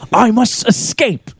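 high, slow British narrator; drawn-out mock British alarm; British nature narrator. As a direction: melodramatic British documentary escape. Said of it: "talking British again".

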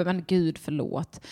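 ordinary talk

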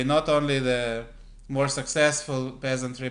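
ordinary talk, nothing marked

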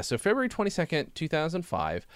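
usual speaking voice

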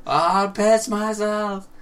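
high, very drawn-out mock plea; hammed-up stretched cry for mercy; tickled pleading. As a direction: wail it like a helpless tickle victim. Callback to tickled victim's plea.

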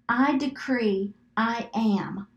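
slow, deliberate declaration; measured declaration, word by word; slow decree. Shown as a demonstration. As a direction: say it slowly for others to repeat. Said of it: "say it slow enough".

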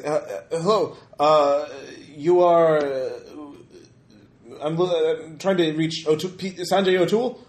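put-on lightly accented English, Arabic speaker; a hint of a Middle Eastern accent. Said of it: "It's accented, though. Uh, English ... Middle, uh, Eastern accent".

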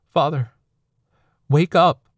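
high, loud cry to wake him; desperate plea. Acted. Son begging his dying father to wake up, loud. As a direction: shout him awake, breaking.

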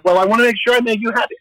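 high, quick mock-flattering Esther; coquettish act. Esther fussing over the king before her request, high put-on act. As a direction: rush it out in a high, eager-to-please put-on voice. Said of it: "she puts on the same act".

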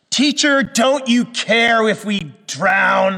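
high, loud, slow accusing wail; edgy cry. Retold or imitated. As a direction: let a sarcastic edge cut through the plea. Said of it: "their cry has a little edge to it".

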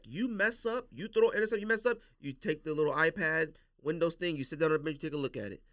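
ordinary talk, nothing marked

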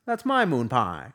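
put-on slow, drawn-out old-timey kid voice; a hint of a scrappy 1910s kid; old-timey tough kid. Old-timey kid in mock fight.

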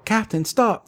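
urgent call. Acted. Tintin calling the wandering captain back, high mock shout.

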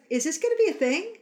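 mock wary surprise. Actress wary at his line, mock surprised. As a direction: ask it half-alarmed. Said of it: "this look on her face like".

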